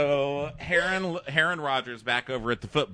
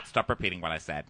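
low, quick, contained command; imitating a man losing patience; mock stern rebuke; 7 semitones lower, 4 dB quieter, faster than usual. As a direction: cut him off in a low voice. Other side of acted squabble.

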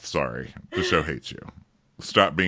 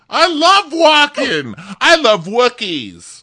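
much higher, louder gushing voice; mock gushing contestant; 9 dB louder, slower than usual. Parodying contestants loving Milwaukee, high gushing. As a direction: gush like an airheaded fan. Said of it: "mock contestant gushing".

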